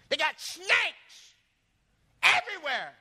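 high, loud, drawn-out warning; grandmother's warning. Retold or imitated. Grandmother telling grandson why he needs a stick, emphatic slow warning.

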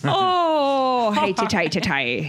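hammed-up Irish lilt; Irish accent. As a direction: lightly put on a joke Irish accent. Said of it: "when we talk in Irish accent".

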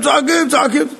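high-pitched, exasperated telling; mock clamour. Prophets calling in vain as nothing lights, high mock clamour. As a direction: pitch it up as futile hubbub.

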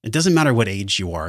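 normal talking voice

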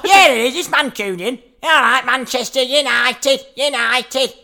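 high, exaggerated Mancunian chant; Mancunian accent. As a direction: play up a Manchester football fan's accent. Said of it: "Is that a Mancunian accent?".

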